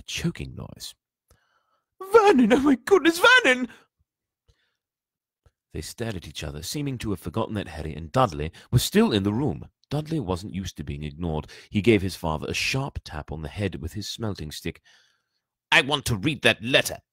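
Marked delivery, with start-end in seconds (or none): 2.1-3.7 very high, loud squeal of alarm; panicked aunt. A narrator's reading. Aunt crying out to husband over the letter, panicked. 15.7-17.0 put-on raised, loud child's demand; said loudly. A narrator's reading. Son demanding the letter from father, loudly. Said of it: "he said loudly".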